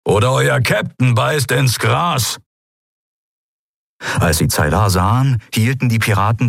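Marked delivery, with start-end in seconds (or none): 0.0-2.4 slow, menacing growl; gruff, higher-pitched warning; growled threat. A narrator's reading. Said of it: "brummte er und drohte".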